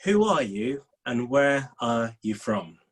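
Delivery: usual speaking voice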